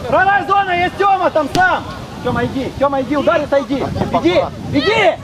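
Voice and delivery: usual speaking voice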